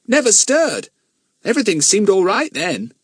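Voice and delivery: put-on a puzzled boy recounting; boy telling Bill about Andros; boy's voice. Jack explains.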